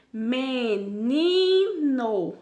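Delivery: "menino" very slow and loud, me - NI - no; stressed-syllable demo. Shown as a demonstration. Teacher showing pupils the tonic syllable, "menino" with long stressed NI. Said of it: "Então, ni, ele é a sílaba tônica".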